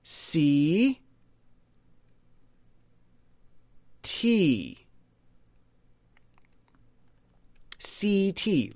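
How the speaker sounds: "C T" with two long E's, see-tee; slow, separated see-tee; D J pronunciation. Shown as a demonstration. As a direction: stretch both syllables apart as long E. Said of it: "The D J pronunciation".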